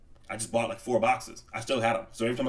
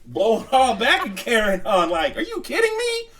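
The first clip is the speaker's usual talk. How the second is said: much higher and louder, laughing at the end; excited disbelief; 12 semitones higher, 6 dB louder than usual. Real, not acted. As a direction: squeal at how ridiculous it is. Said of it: "beehive on a motorcycle".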